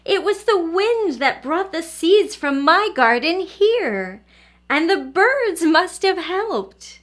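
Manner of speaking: put-on the old lady overjoyed to find her lupins on the hill, delighted. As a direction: burst out in joyful discovery. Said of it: "she said as she knelt in delight".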